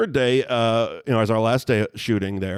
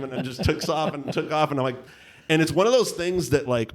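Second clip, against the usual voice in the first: usual speaking voice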